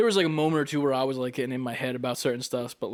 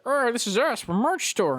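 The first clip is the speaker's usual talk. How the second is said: high, bright salesman pitch; cheesy promo voice; 10 semitones higher than usual. Pretend. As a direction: pitch the merch like an ad. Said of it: "That sounds just like us".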